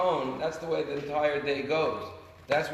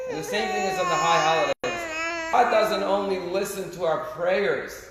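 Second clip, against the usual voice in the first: rising, louder, near-tearful cry from the pulpit; impassioned preaching; 3 semitones higher, 7 dB louder than usual. Real, not acted. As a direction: push it out with full fervour, slowing down. Preacher's emotional climax.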